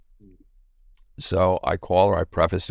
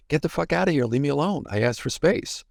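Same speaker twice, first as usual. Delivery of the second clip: higher-pitched imitation of an exasperated wife; retold exasperation; 8 semitones higher than usual. Man voicing wife rebuffing his needy texts, exasperated.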